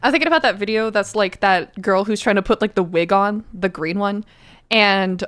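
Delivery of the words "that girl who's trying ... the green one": ordinary talk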